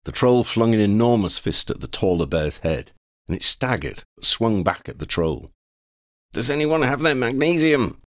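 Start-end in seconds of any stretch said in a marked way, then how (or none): 6.3-8.0 shouting. Acted. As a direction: call out over the fight. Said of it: "Oddie shouted".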